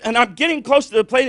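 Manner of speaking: ordinary delivery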